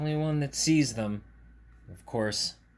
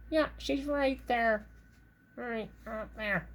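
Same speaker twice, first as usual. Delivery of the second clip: high puppet squirrel voice; Red Squirrel; 8 semitones higher, slower than usual. Acted. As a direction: answer in a high, measured critter voice. Red Squirrel puppet points out creature.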